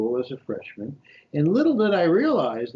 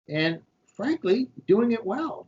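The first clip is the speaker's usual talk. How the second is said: playful boast; 9 semitones higher, slower than usual. Real, not acted. Boasting about childhood skill.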